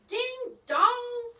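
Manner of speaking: high, loud magic cry; yelled spell. Acted. Muffletup casting a spell at storm clouds, yelling. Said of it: "Muffletup yelled".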